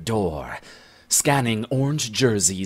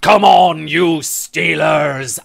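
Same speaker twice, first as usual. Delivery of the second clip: loud, high yell, slowing on the end; 5 semitones higher, 7 dB louder than usual. Acted. Ginger Scot yelling at the fighting men: loud shout. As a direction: bellow it at the skaters. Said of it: "as she yells for her pride".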